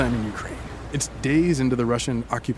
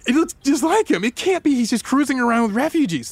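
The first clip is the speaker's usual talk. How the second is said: much higher, stunned disbelief; astonished gasp; 10 semitones higher than usual. Retold or imitated. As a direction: gasp it out in shock. Gasping discovery of Zelensky.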